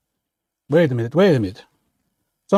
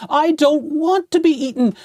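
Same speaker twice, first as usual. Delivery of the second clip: scared skeleton; 10 semitones higher than usual. Acted. Skeleton terrified.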